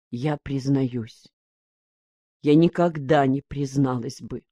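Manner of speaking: low, soft, understated tearfulness; quietly confess, holding back tears; tearful confession. A narrator's reading. Mother confessing before everyone, mildly tearful. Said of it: "Её душили слёзы".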